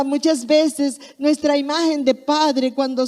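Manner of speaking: preaching. Acted. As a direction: drive it home to the congregation.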